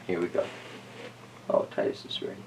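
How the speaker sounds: hushed whisper. Real, not acted. Telling viewers Titus is ready, whispered. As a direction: keep it a whisper so the bell can ring. During quiet mindfulness moment.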